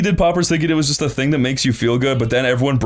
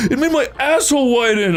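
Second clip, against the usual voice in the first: mock crying; 11 semitones higher than usual. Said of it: "he was, like, crying about it".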